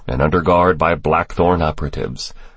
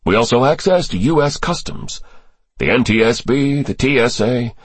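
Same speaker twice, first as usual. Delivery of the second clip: higher-pitched character voice for testimony; briefing official; 8 semitones higher than usual. Acted. Official briefing senators on terrorist ties, higher character voice. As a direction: lay out the intelligence in a raised register.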